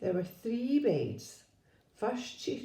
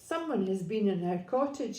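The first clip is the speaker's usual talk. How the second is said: ordinary delivery, nothing marked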